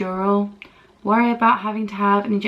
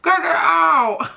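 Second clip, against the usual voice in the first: higher, louder, slow, breaking cry of get it out; desperate half-crying plea; 8 semitones higher, 5 dB louder, slower than usual. Retold or imitated. Patient begging the dentist to remove the tooth, crying out. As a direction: beg through tears, dragging it out. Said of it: "I was like".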